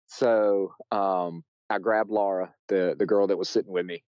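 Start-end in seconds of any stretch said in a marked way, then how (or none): none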